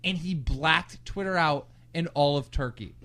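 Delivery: ordinary talk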